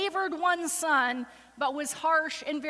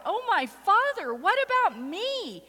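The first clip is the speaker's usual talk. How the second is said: begging cry; 8 semitones higher, 3 dB louder than usual. A narrator's reading. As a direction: cry out to a father for a blessing. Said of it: "he begged".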